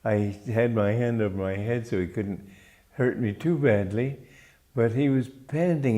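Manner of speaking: ordinary delivery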